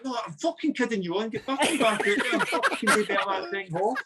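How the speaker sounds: high, laughing mock boss voice; laughing gaffer. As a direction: scold him while cracking up. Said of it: "He's like".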